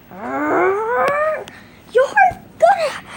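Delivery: climbing high, loud yell; rising loud battle scream; furious yell. Acted. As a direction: yell it as you lunge. Attack cry.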